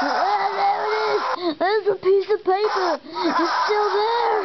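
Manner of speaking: high, wailing pretend cry; hammed-up high crying shout; mock wailing cry. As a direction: wail it out like a scared kid playing. Character cries out about paper.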